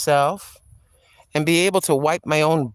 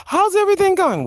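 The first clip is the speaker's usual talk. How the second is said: asking Tim; 14 semitones higher, 3 dB louder than usual. Retold or imitated. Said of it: "I have to pull information".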